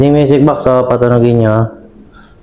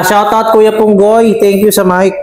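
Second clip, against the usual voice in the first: excited; 10 semitones higher, slower than usual. Real, not acted. Giving thanks on camera.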